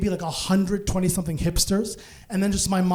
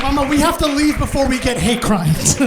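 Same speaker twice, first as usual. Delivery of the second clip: urgent plea; 4 semitones higher, 5 dB louder than usual. Acted. Son urges mom to flee.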